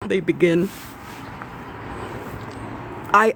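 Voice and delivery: ordinary talk, nothing marked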